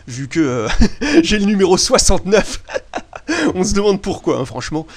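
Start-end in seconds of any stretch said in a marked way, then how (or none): 2.7-3.5 high, quick giggle; amused laugh. Real, not acted. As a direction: giggle at a silly joke. Rit du numéro soixante-neuf. (petit rire aigu et amusé)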